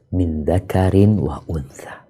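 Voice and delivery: ordinary talk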